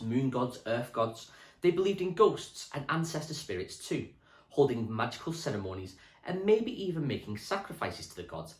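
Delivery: put-on steady storybook reading for children; reading aloud to class. Teacher reading a history book to Year six, storybook voice. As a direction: read the book to the class clearly.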